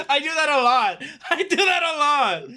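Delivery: high, loud, laughing confession; laughing admission. Real, not acted. Admitting to the other host he does that, laughing. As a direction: own up while cracking up.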